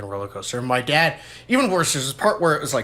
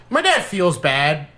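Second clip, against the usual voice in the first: ordinary delivery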